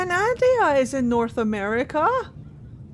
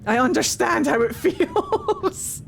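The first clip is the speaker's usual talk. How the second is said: laughing; 5 semitones higher, slower than usual. Real, not acted. Laughing through apology.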